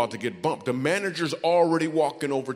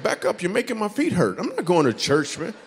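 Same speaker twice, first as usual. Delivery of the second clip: higher, louder comic brush-off; mock protest; 6 semitones higher, 6 dB louder than usual. Comedian refusing the church invite, mock protest. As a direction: push him away with comic indignation. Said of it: "mock refusal to manager".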